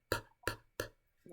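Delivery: P as bare puffs of air, no vowel; voiceless P sound. Shown as a demonstration. Showing students that the P has no voice, voiceless puffs. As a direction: pop P, p, p slowly without any voice. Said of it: "la P no suena, mira".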